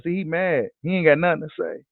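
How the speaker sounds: ordinary talk, nothing marked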